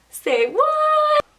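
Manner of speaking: high-pitched, drawn-out "say what"; exaggerated incredulous reaction, voice sliding way up; hammed-up shock; mock disbelief. Retold shocked reaction, replayed.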